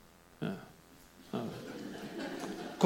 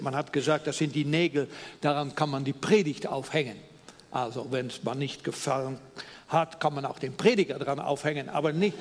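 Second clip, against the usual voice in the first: ordinary talk, nothing marked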